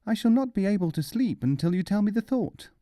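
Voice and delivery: the wife demanding, voiced high; high wife's voice. A narrator's reading. Wife demands the thought, high voice. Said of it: "she said".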